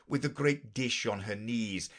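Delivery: plain reading voice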